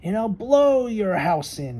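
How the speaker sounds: high, loud squawking threat; squawking eagle. Acted. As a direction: screech it like a hungry bird of prey. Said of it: "he squawked".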